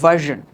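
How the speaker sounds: "ভার্সন" as VUR-zhun, buzzed zh; firm, loud model of "ভার্সন" with zh; correct zh pronunciation. Shown as a demonstration. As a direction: say "ভার্সন" with zh, no hard r. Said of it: "কি বলতে হবে?".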